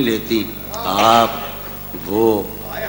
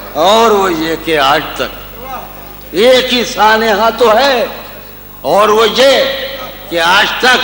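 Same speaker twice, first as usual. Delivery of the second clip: raised, emphatic poetic recital; tender romantic poetry; 6 semitones higher than usual. Acted. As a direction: declaim the couplet with feeling. Recites a couplet.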